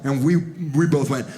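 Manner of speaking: ordinary talk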